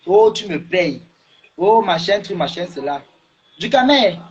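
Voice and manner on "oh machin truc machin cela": usual speaking voice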